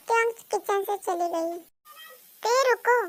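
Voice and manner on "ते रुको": urgent, louder and higher call; raised voice, higher pitch, urging her back; calling after someone. Acted. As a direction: call out to stop her leaving. Calling after her as she leaves.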